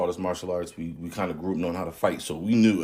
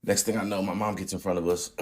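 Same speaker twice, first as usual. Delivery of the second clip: normal talking voice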